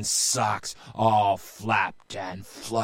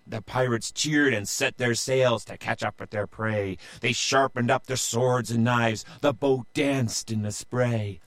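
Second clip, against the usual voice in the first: usual speaking voice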